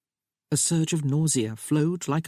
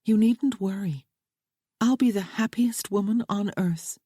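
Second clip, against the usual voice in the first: a higher, lightly voiced young woman, earnest and pleading; young bride Sophia; 6 semitones higher than usual. A narrator's reading. Bride reassuring her new husband, raised lighter voice. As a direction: play the bride gently begging James to understand.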